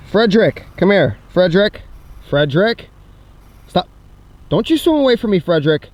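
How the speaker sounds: loud, insistent call. Acted. Vlogger calls to Frederick swimming away, calling out. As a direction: call him back, firmly and loudly.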